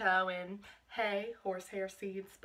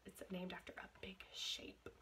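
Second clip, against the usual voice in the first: whisper; 3 semitones lower, 16 dB quieter than usual. Real, not acted. Hinting quiz answer to kids.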